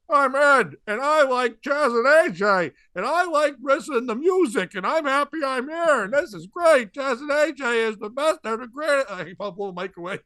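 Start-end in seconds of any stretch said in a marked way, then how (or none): 0.1-9.1 high, loud imitation of an odd little old man; mock an over-eager, rambling oddball on the radio; Ed Seward. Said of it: "He talks like this".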